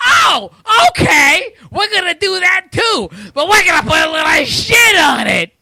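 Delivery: high, loud, hammed-up company voice; mock a greedy exec shouting his big idea; Nintendo impression. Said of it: "that's my impression of Nintendo".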